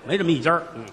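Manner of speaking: ordinary delivery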